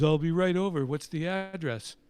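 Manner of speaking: loud, high, instant yes; eager star. Retold or imitated. Star telling the caller he's coming right over, loud and eager. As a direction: jump at the chance.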